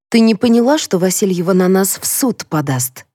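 ordinary delivery, nothing marked

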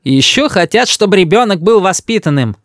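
high-pitched grandmotherly reproach; indignant. A narrator's reading. Grandmother criticises parents' upbringing, indignant. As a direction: complain in righteous indignation.

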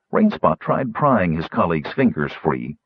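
plain reading voice

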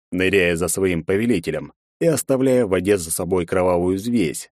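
ordinary delivery, nothing marked

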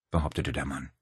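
ordinary delivery, nothing marked

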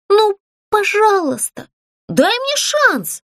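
high, slow plaintive plea; begging with a wobbling lip; whiny pleading. Acted. Puppet begs the ghost for a chance, whiny. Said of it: "голос показался плаксивым".

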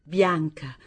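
ordinary delivery, nothing marked

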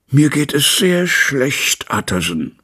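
put-on a sick man answering his old friend gloomily; gloomy. A narrator's reading. Jekyll am Fenster antwortet seinem Freund Utterson, trübsinnig und hoch. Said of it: "antwortete der Doktor trübsinnig".

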